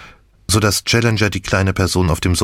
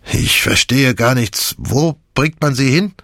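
higher-pitched, agitated questioning; bewildered; 6 semitones higher than usual. A narrator's reading. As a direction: ask in helpless confusion. Bewildered man grabs narrator's arm.

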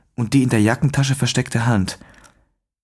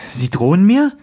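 empört überrascht; 7 semitones higher, 4 dB louder than usual. A narrator's reading. Daniel erschrocken, empört.

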